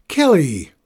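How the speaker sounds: loud shout across the swamp; shouting at her sister. A narrator's reading. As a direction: call her name out sharply. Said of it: "shouted at her".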